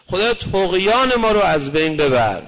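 loud, urgent cry to God; pleading prayer. Shown as a demonstration. Preacher crying out to God in loud prayer. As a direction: call out the plea with force.

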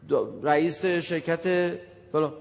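normal talking voice

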